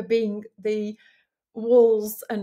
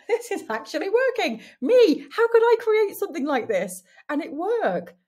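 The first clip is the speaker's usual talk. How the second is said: much higher and louder, thrilled disbelief; flabbergasted; 10 semitones higher, 8 dB louder than usual. Retold or imitated. Reliving her course's first success, thrilled disbelief. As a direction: exclaim it as if you can't believe your luck. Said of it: "I was flabbergasted".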